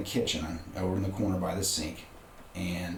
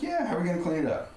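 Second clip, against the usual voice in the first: upbeat encouragement; 11 semitones higher, faster than usual. Retold or imitated. Cheerful encouragement to son.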